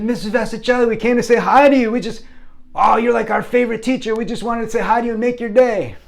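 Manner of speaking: put-on high, eager kid voice; gushing schoolboys. Sixth graders gushing to their favorite teacher, excited. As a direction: pour it out high and loud with adoration.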